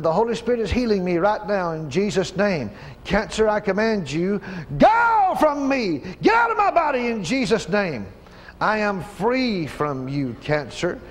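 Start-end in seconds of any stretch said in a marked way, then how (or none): 4.8-7.1 commanding shout. Real, not acted. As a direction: order it out with full force. Commanding cancer to leave.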